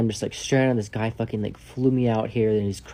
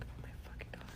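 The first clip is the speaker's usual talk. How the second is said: barely audible whisper; stunned whisper; 22 dB quieter than usual. Retold or imitated. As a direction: whisper it under your breath. Inner reaction to crazy guy.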